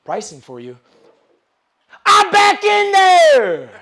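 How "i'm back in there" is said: triumphant shout. Retold or imitated. Triumphant shout in sales story.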